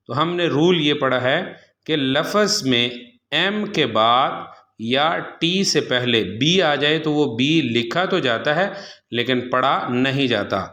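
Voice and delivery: usual speaking voice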